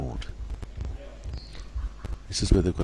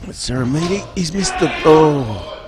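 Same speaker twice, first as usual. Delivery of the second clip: high-pitched cry of dismay, getting louder; excited miss call; 9 semitones higher, 7 dB louder, slower than usual. Real, not acted. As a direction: react live to the missed penalty, voice shooting up. Penalty missed in live play.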